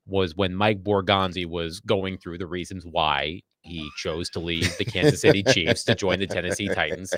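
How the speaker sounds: normal talking voice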